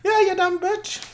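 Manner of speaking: put-on very high, sneering mean girl. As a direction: sneer it like a snotty bully. Voicing the bully character.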